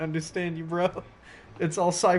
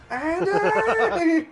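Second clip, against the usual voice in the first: anguished cry; 5 dB louder than usual. Retold or imitated. Reenacting the dramatic cry.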